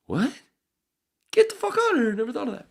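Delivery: высоко и громко, наигранное изумление; изобрази ошеломлённого слушателя; mock astonishment. Mock listener amazed reaction.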